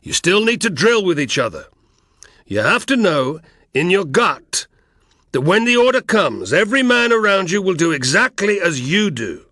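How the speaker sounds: loud and high, bellowing at the men; roaring drill speech. Acted. Egbeo drilling the rebel cohort, roaring. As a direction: roar it across the training ground. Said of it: "the Thracian had roared over and over".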